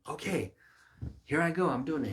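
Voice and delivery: high, loud eager acceptance. Retold or imitated. Preacher acting the response the ruler didn't give, eager. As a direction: jump at it keenly.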